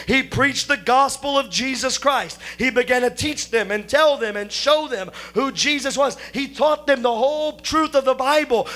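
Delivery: high, ringing pulpit delivery, phrases building; lifted sermon cadence; fervent preaching. Acted. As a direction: preach it out to the congregation. Preaching with rising fervour.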